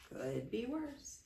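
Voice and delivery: drawn-out unbothered grandpa; lightly voiced old grandpa; flat grandpa. A narrator's reading. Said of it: "he always said the same thing".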